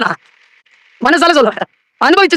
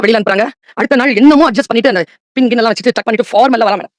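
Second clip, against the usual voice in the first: ordinary delivery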